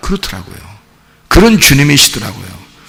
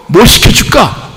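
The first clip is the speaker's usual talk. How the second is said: doting parent; 7 semitones higher than usual. Acted. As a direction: marvel at the kids like a proud parent. Parent voiced offering food.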